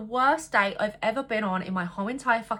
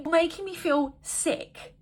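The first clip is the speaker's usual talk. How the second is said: disgusted whine; 6 semitones higher, slower than usual. Real, not acted. Disgusted reaction, drawn out.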